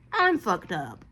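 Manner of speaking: normal talking voice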